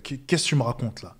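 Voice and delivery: ordinary delivery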